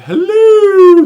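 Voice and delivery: very high, loud, drawn-out silly hello; Seinfeld reference hello. Retold or imitated. Host quotes Seinfeld episode, silly high voice. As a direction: belt out a goofy high sitcom catchphrase. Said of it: "That's a reference to a Seinfeld episode".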